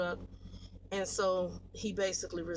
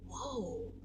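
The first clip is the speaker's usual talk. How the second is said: repeated whoa trailing off softer; shocked whoa; 3 semitones higher, 6 dB quieter, much slower than usual. Retold or imitated. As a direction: pull back in disbelief. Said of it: "I'm like".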